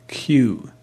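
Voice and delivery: "Kew." breathed with no voice; whispered. Acted. Jerry murmuring the name to himself, whispered. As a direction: drop to a bare whisper on "Kew.".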